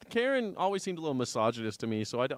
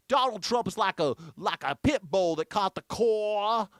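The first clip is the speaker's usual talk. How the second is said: Southern impression; 5 semitones higher, 3 dB louder than usual. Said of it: "he doesn't talk like this".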